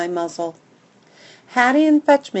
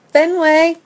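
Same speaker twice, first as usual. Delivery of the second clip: hopeful call; 8 semitones higher, 7 dB louder than usual. A narrator's reading. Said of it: "she calls in a hopeful voice".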